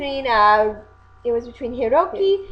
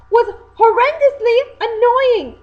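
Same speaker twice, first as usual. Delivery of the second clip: horrendously annoying, pitched way up and slow; exasperated; 10 semitones higher, 6 dB louder than usual. Real, not acted. Ranting about the dub voice, exasperated and high. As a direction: let the annoyance burst out.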